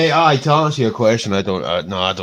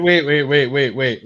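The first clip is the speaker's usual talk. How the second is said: louder urgent waits; loud interruption; 3 semitones higher, 8 dB louder than usual. Real, not acted. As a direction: cut him off sharply. Interrupting to stop him.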